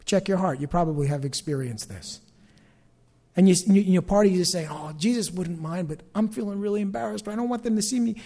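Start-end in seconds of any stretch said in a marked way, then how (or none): 4.7-8.2 hushed, softer private admission; embarrassed inner voice. Acted. Part of you not wanting to be seen, hushed embarrassed thought. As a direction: drop the volume as if confessing to yourself.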